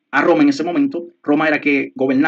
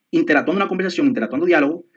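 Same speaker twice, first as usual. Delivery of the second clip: ordinary talk, nothing marked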